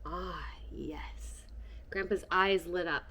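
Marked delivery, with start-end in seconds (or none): 0.0-1.4 soft knowing whisper. Acted. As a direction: breathe it out, pleased with the secret. Grandpa's secretive reply.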